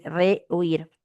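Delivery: "rehuir" slowly, split with a pause after "re"; separado en sílabas. Shown as a demonstration. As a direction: say "rehuir" in two parts, re, pause, huir. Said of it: "Se separa la E y la U". ("rehuir" pausado, separando "re" de "huir")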